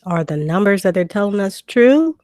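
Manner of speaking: ordinary delivery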